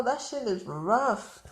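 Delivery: usual speaking voice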